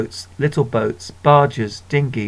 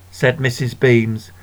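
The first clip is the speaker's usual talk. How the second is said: ordinary delivery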